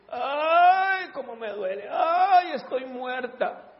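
high crying ay, then drained murmur; mock wail of pain. Preacher mocking a complaining sister, hammed-up wail. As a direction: overdo the suffering. Mimics a complainer saying it hurts.

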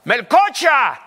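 loud call of the horse's name; shouted call. Retold or imitated. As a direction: yell the name across the field. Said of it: "le gritaba".

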